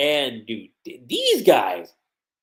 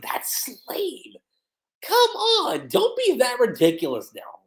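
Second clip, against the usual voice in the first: high-pitched acted protest; mock exasperation; 8 semitones higher than usual. Lucy telling Natsu off, exasperated. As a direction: play exasperated, voice jumping up high.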